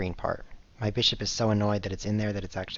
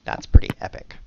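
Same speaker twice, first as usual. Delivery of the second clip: talking through a real laugh; 9 dB louder than usual. Real, not acted. Streamer joking to Twitch viewers about lol, laughing. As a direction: laugh out the words, louder. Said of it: "Look, I'm lolling".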